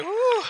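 high, loud exhale of relief; big relieved whew. Retold or imitated. After quitting his job, a big loud relieved whew. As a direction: blow out a huge whew of relief. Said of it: "I was like".

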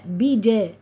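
"بيجا" with an open a after j; correct open vowel. Shown as a demonstration. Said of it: "لا،".

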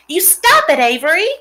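put-on a girl shouting her brother's name in alarm; crying out. A narrator's reading. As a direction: yell at him to stop. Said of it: "cried Fern".

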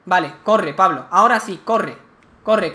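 loud urgent commands to run; urging yourself to flee; being chased. Real, not acted. Running from enemy in game.

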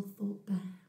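usual speaking voice

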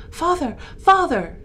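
high, loud call for father; alarmed shout, falling on each call; urgent cry. A narrator's reading. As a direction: cry out urgently to wake him. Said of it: "cried I".

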